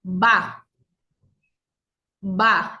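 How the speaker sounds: Ba with the vocal cords buzzing; voiced b demo. Shown as a demonstration. Teacher contrasting Ba with Pa for the class. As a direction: voice Ba fully from the throat. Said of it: "Ahora digamos".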